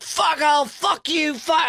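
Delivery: high, slow mock shout of rage; furious cyclist. Australian cyclist screaming at him in the bike lane, slow mock shout. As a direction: spit the threat out slowly. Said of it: "He's like".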